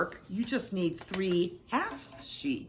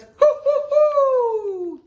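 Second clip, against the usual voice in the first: high, loud owl hoots; owl hooting; 11 dB louder, much slower than usual. A narrator's reading. Owl answers from the hole, hooting. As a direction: hoot like an owl, last one drawn out. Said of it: "an owl says".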